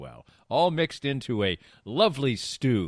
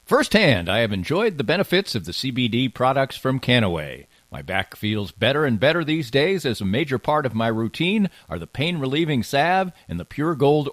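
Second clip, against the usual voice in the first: bright sponsor read for radio listeners; upbeat ad read; 4 semitones higher, 5 dB louder than usual. Acted. Host pitching sponsors to podcast listeners, upbeat ad read. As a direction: sell it like a friendly radio spot.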